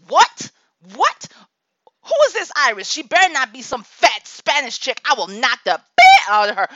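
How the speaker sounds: loud, high outraged shouts; hammed-up loud threat, half laughing; mock furious rant. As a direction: blow up like a protective mom. Furious retort to Iris story.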